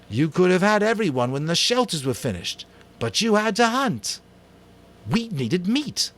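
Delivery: high, loud, stubborn retort; defensive shout. Acted. Jack snaps back at Ralph defending the hunt, angry. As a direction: hit back hard at the blame.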